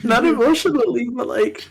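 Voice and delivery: very high whimpering complaint; tearful, not emotional. Real, not acted. Stuffy, sick host pleading for relief, tearful whine. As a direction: whimper through a stuffy head. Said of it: "You're making me cry".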